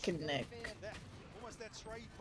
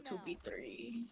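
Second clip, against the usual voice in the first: whisper; 14 dB quieter, slower than usual. Real, not acted. Tense moment in close round.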